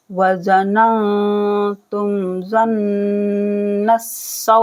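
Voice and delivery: ज़न्नस्सौ with a hummed doubled nun; slow recitation with ghunna; nasal ghunna. Shown as a demonstration. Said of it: "नून पर गुन्ना किया जाएगा".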